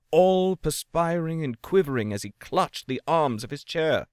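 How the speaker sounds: usual speaking voice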